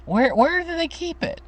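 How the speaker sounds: put-on high, lost old lady voice; confused old grandma. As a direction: puzzle over the kitchen, up high. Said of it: "And she'd say".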